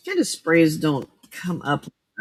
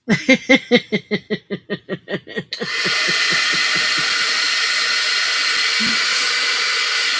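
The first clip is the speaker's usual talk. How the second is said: long laugh; 4 dB louder than usual. Real, not acted. Long laugh after crafting.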